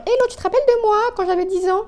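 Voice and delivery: high, fast, fake-bright hello; mock cheery greeting. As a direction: play it as an awkward overeager message. Mock message to old friends.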